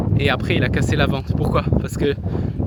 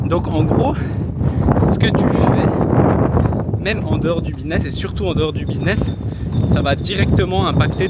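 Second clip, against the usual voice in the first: raised voice against the wind; shouting over wind; 5 dB louder than usual. Real, not acted. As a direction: push the voice over a gust. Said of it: "il y a énormément de vent, j'espère que vous m'entendez".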